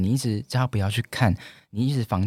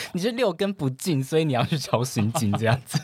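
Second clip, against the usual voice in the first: usual speaking voice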